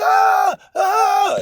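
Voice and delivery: imitated high wail; wailing cry. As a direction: cry out high like a desperate woman. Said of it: "one woman was crying out".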